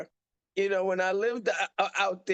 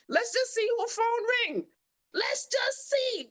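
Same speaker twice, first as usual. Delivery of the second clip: taunting challenge. Retold or imitated. Mom taunting aide.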